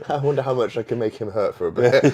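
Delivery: laughing through a mock-sinister thought. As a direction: giggle while plotting the new guy's pain. Laughing, voicing his own old thought.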